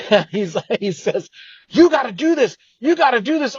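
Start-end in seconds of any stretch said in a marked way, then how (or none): 1.7-3.6 much higher, bursting with excitement; gushing friend urging him on; excited friend. Retold or imitated. Friend on the phone insisting, excited and high. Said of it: "Sam got super excited".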